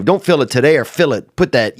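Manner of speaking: ordinary talk, nothing marked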